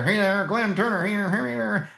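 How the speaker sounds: high, bright stage host voice; mock showman voice. Mocking the showy speaker to the audience, high hammed-up showman voice. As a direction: play the slick speaker.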